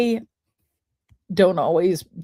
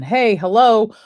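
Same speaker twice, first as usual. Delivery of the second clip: urgent body voice; 5 semitones higher, 4 dB louder, faster than usual. Acted. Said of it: "our body's way of saying".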